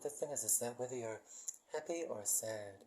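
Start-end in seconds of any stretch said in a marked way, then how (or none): none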